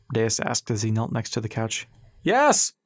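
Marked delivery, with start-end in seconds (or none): 2.2-2.7 high, loud pained yell; a strained, high-pitched shout of agreement; half screaming. A narrator's reading. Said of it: "still half screaming".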